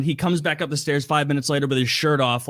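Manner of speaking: normal talking voice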